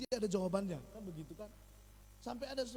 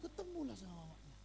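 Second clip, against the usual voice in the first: normal talking voice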